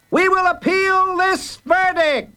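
very high, slow ringing declaration; bell-like voice. A narrator's reading. Child heroes challenging the judge's verdict, ringing and bold. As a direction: ring out high and clear. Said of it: "a bell-like voice rang out loud and clear".

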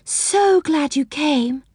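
high, drawn-out joyful welcome; gushing, stretched-out greeting; delighted cry. A narrator's reading. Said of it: "cried Silky".

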